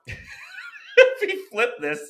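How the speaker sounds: high, slow, through a laugh; can barely hold back a laugh. Real, not acted. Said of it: "trying to say this with a straight face".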